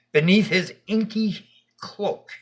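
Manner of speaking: usual speaking voice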